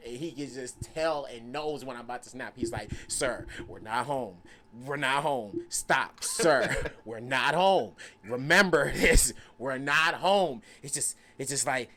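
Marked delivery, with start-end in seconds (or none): 3.1-10.6 low, slow cautioning voice; measured warning. Retold or imitated. Storyteller voicing uncle calming him, slow low warning. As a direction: rein him in slowly, pitched low.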